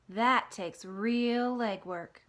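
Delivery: very slow, knowing spider; slow tease. Acted. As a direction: stretch it out teasingly. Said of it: "Charlotte teasing".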